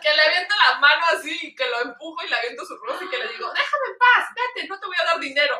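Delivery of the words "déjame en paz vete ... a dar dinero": high-pitched mock outburst; retold anger. Tourist tells the rose seller to leave, retold angry outburst. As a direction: act out telling someone off, pitched up. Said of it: "me enojé".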